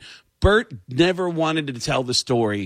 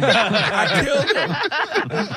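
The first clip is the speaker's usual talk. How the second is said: "I killed him" drawn out slow, much higher, giggling; Tracy Morgan impression; 10 semitones higher, slower than usual. Finishing the fake confession to Bert, slow high Tracy giggle. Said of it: "I was gonna kill him as Tracy Morgan".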